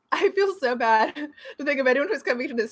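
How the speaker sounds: loud, high, through real laughter; laughing. Real, not acted. Streamer amused by the live chat, laughing. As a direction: crack up while talking, voice pushed up.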